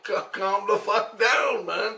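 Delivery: high, slow mock plea; re-enacted incredulous thought; exasperated protest. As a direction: draw it out high, half amused. Acted thought, laughing at the yeller.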